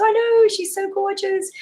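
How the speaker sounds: high, slow gushing mother voice; doting mother. Retold or imitated. Mother praising her baby to a friend, gushing. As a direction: coo it like a proud mum.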